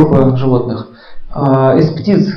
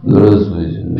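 general Lebed's low bass; extremely deep bass voice; 10 semitones lower, slower than usual. Retold or imitated. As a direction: greet like a heavy gruff general. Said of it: "такой был генерал Лебедь". (бас генерала Лебедя)

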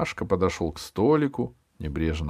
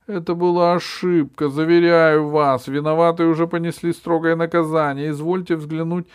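high, loud groveling apology; high fawning voice; 9 semitones higher, 7 dB louder than usual. A narrator's reading. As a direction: grovel politely.

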